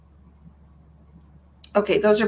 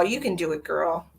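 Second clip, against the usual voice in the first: ordinary talk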